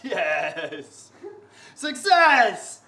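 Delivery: building to a high, loud cheer; mock triumph. Acts out gleeful reply to complaint.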